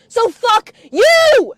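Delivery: loud, slow and drawn out; angry shout. Real, not acted. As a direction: yell it with a long final word. Ranting at absent grandfather.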